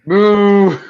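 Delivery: loud, high jeer at a rival game; mock booing. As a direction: boo it like a heckling crowd. Jeering at a disliked game.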